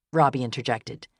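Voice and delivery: ordinary delivery, nothing marked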